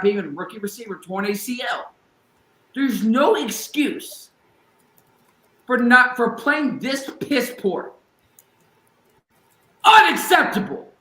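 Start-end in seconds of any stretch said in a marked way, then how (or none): 2.7-10.9 building from slow and loud to an all-out high yell; rising fury at the team until he explodes; passionate rant. Real, not acted. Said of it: "I don't wanna lose my voice".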